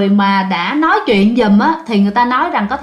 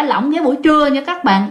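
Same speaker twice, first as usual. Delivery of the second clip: ordinary delivery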